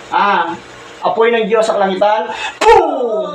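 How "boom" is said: a pitched-up, punchy "boom" like a blast from heaven; mock explosion. Real, not acted. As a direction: burst out with the sound of a thunderclap. Healer calls down God's fire.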